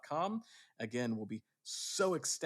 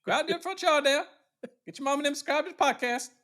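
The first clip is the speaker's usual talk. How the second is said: mock Southern accent; 13 semitones higher, 5 dB louder than usual. Host to listeners, in a hammed-up Southern accent. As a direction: play an exaggerated Southern relative calling out.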